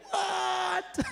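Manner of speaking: high, laughing mock shock; shocked what. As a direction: squeal it out in amused disbelief. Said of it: "They go".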